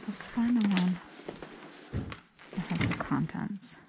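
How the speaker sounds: whispered aside; hushed whispered aside. Real, not acted. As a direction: whisper it to yourself. Whispering while searching.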